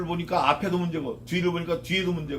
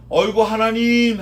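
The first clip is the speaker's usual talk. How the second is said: drawn-out, louder sigh of a cry to God; 5 semitones higher, 9 dB louder than usual. Retold or imitated. Believer in trouble sighs out a cry to God. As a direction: groan it out to God, slow and loud. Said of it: "하고 부르면".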